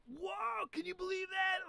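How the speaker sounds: high, hammed-up shout of disbelief; imitated amazed crowd. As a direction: play a bystander crying out in amazement. All the people reacting in shock.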